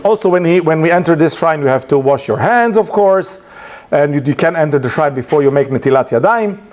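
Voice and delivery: ordinary delivery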